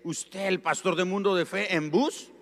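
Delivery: loud, high, astonished question; incredulous question. Retold or imitated. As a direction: imitate a church brother's shocked disbelief. Church brother astonished at the pastor.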